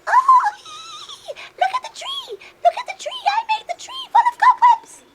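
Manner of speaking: very high giggly spider voice; gleeful spider. Acted. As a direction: squeal with tiny mischievous glee.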